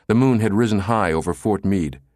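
plain reading voice

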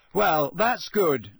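slow, loud, bitter sarcasm; a sarcastic outburst, drawn out and loud. A narrator's reading. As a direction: say it loud enough for all to hear. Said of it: "said Harry loudly".

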